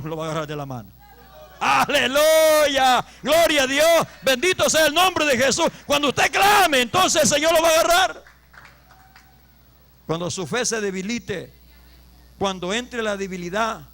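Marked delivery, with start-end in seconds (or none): 1.6-8.2 loud, high praise shouted out; full-force preaching. Real, not acted. Preacher praising God before congregation, full-force preaching. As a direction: open with a long-drawn hallelujah, then keep proclaiming.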